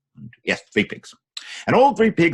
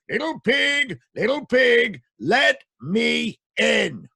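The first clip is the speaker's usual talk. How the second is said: higher, drawn-out big bad wolf call; 10 semitones higher, much slower than usual. Wolf calling to the pig at his door. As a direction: boom it out like the wolf. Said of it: "in a great big voice".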